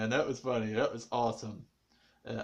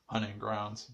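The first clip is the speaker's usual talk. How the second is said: muttered name; 6 semitones lower, 7 dB quieter than usual. Shown as a demonstration. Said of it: "They call it hunting grounds".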